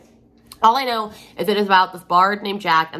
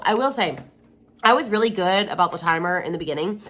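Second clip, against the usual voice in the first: ordinary talk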